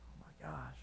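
whispered in awe; stunned whisper; breathless whisper. Real, not acted. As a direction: barely breathe it out. Jesus looks at Peter.

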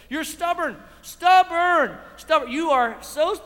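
preacher calling out stubbornness in the congregation; loud accusation. Acted. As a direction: hammer the word stubborn.

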